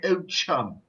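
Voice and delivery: put-on posh English accent. Mimicking how posh people in England greet, posh English accent. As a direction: imitate upper-class English politeness. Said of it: "posh people in English, in England".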